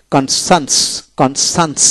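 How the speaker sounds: "কনসান্স" with s sounds for the c's; pronunciation demo. Shown as a demonstration. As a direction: carefully model "কনসান্স". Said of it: "এভাবে উচ্চারিত হবে".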